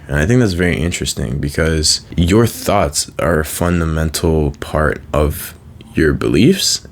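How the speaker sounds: ordinary talk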